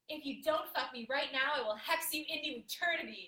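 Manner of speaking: loud, fierce, high threatening demand; aggressive. Acted. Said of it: "It's aggressive".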